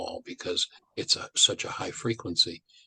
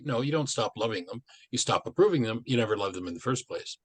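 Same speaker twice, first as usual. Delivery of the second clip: ordinary delivery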